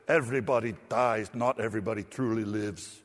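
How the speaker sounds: a sermon's mock Scottish accent, deliberately overdone; bad Scottish accent. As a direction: deliver it like a clumsy Scottish movie hero. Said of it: "Scottish accent is almost as bad as Mel Gibson's".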